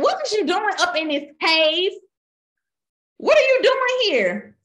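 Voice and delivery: high, ringing voice of God demanding; God's voice. Retold or imitated. God demanding of Elijah in the cave, high and ringing. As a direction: raise it up as God calling out. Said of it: "He said".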